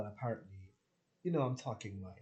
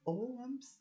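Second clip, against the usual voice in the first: high flustered self-quote; 11 semitones higher than usual. Retold or imitated. Acting out her own flustered reply.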